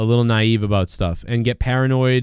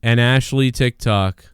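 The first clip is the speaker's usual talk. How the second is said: mock announcer. Presenting a TikTok like announcer.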